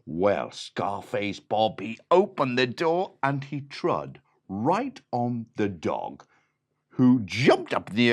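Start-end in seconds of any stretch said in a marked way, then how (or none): none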